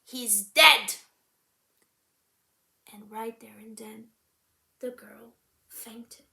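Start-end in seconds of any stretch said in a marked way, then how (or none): 0.0-1.0 blunt. Acted. Blunt news of death.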